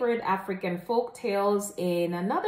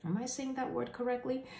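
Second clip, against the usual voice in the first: usual speaking voice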